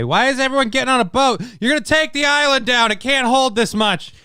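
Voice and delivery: high, alarmed voice; panicked yelling. Pretend. Imagined Hawaiians panicking, high.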